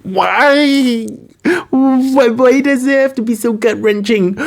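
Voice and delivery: high, whiny fake sob; mock wailing. Fan lamenting the gut-wrenching scene, high hammed-up wail. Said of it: "I was like".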